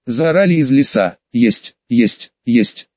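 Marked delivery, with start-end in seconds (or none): 1.3-2.9 low, distorted echo of a voice; a warped copy of his own voice, lower; eerie mimicked voice. A narrator's reading. Forest thing mimicking his voice.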